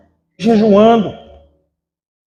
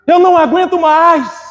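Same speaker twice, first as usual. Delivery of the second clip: higher, pleading outcry; retold cry; 7 semitones higher than usual. Regiane crying out during the preaching, pleading. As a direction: cry that you can't take any more. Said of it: "ela gritava".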